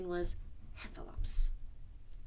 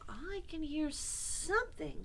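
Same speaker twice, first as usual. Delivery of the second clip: put-on slow, higher-pitched, hushed boy; Christopher Robin; 8 semitones higher, slower than usual. A narrator's reading. Christopher Robin nearing the trap, slow and high. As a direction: say it slowly, listening out. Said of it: "said Christopher Robin".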